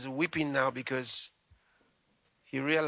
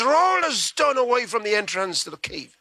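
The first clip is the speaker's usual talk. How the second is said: commanding Jesus; 7 semitones higher, 5 dB louder than usual. Acted.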